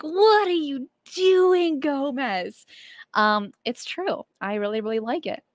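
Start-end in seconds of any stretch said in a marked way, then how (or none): 0.0-2.6 mock incredulous question, higher and drawn out slowly; imitating Jimmy. Host mimicking co-host Jimmy's reaction to her chocolate praise, mock baffled. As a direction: tease him by playing his puzzled reaction. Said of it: "And Jimmy's like".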